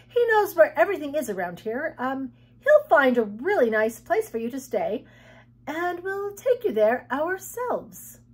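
high, loud, hesitant coaxing; flustered girl, placating. Acted. Girl stalling an unhappy guest, anxiously placating.

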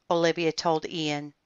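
ordinary delivery, nothing marked

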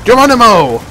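loud, high leaping yell; big jump cry; shouting Geronimo. Real, not acted. Jumping downstairs.

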